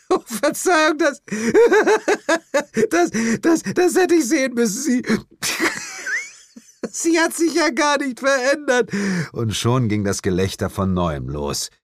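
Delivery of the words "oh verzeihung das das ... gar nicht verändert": much higher, speech broken by laughter; laughing helplessly. Acted. The woman doubles over laughing while apologizing. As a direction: barely get the words out for laughing. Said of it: "Jane krümmte sich vor Lachen".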